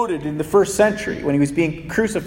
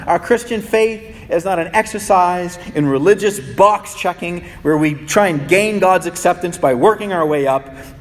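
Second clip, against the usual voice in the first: usual speaking voice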